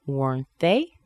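"Weren't they" rising steeply at the end; rising intonation. Shown as a demonstration. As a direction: lift "they" as a question. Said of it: "Going up in intonation".

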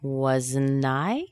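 "Wasn't I" rising steeply at the end; rising intonation. Shown as a demonstration. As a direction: lift "I" as a question. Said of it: "Going up in intonation".

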